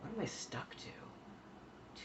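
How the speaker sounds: whispered aside to herself. Real, not acted. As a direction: breathe it under your breath. Muttering to self mid-stream.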